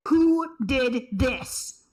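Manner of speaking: slow, loud, furious demand; furious shout. Real, not acted. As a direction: yell it out in real rage. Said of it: "You went full vindictive there".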